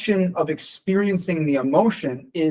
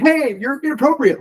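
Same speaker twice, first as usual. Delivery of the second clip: abrasive tone; 11 semitones higher, 4 dB louder than usual. Shown as a demonstration. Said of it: "there's a different tone".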